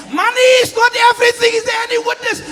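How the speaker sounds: high, loud, fast shouted refrain; preacher at full cry; preaching at full force. Acted. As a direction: build to a roaring climax. Preaching climax.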